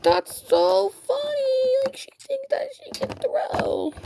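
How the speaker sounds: very high, slow, squeaky words through laughter; laughing hard. Retold or imitated. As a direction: talk while crying with laughter. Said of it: "laughing my freaking ass off".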